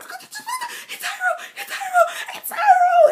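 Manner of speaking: high, loud, laughing shriek; screaming with joy. Retold or imitated. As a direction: scream the name over and over in delight. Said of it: "And I was screaming".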